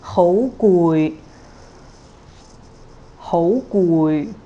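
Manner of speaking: hou rising, gui low; rising then low tone. Shown as a demonstration. Teacher models hou gui, rising then low. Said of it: "Next one is similar".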